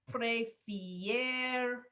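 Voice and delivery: "prefieren" broken into long, separate syllables; "prefieren" slowly, pausing before the final syllable; drawn out by syllable. Shown as a demonstration. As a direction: stretch "prefieren" out piece by piece as it is built. Building the conjugated verb piece by piece.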